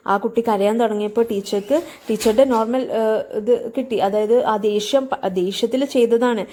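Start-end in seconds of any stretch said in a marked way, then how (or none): none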